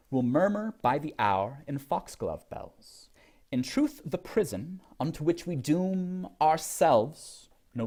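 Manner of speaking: slow, measured recital of a sonnet; poetry reading. Acted. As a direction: read it like a poem, with care. Reads poem aloud.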